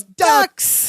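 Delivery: high, excited call at sighting prey; excited cry. Retold or imitated. Retelling Larry spotting ducks overhead, excited cry. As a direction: shoot up high on the one word.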